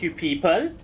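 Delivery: "you people" ending on a rising lilt, like a question; Welsh rising tune. Shown as a demonstration. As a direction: lift "people" up at the end, Welsh style. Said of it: "the way he ended that sentence".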